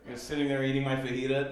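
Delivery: ordinary delivery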